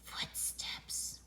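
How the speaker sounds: very soft, breath-only whisper. A narrator's reading. Boy hearing someone approach the tent, whisper. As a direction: whisper it, barely audible. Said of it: "whispered Buster".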